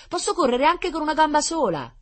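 put-on very high, loud little-kid plea; pleading child. A narrator's reading. Boy with a plastered leg insisting, high pleading. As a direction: beg eagerly in a high voice. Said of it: "implorava un bambino con la gamba ingessata".

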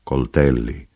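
low, gentle single word; voce dolce e profonda. A narrator's reading. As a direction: say it deep and tenderly. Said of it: "con voce dolce, profonda". (dolce, profondo, sommesso)

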